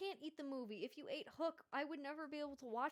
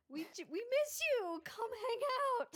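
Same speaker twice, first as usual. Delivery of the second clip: calling out; 13 semitones higher, 7 dB louder than usual. Acted. Calling out to old friends.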